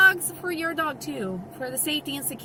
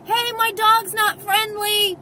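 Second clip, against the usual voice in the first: higher, louder shouted warning; re-enacted yell; 7 semitones higher, 6 dB louder than usual. Dog owner yelling to a distracted stranger, re-enacted yell. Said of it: "and then I gotta yell".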